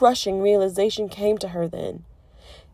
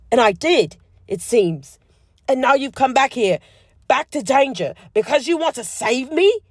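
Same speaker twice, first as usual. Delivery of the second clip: higher, louder, faster rising outburst; incredulous anger; 6 semitones higher, 5 dB louder than usual. A narrator's reading. Draco to Hermione about danger, incredulous rising anger. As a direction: build to disbelieving fury.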